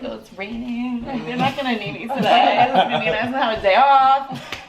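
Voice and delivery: laughing hopeful inner voice; hopeful self quote. Retold or imitated. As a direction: play your past self hoping for a day off. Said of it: "I was like".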